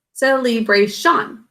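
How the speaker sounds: slow "celebration" as sel-ee-bray-shan; spelling pronunciation. Shown as a demonstration. Teacher blending the spelled syllables back together, "celebration" as sel-ee-bray-shan. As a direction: say "celebration" with a clear ee and a full shan. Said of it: "to pronounce this as celebration".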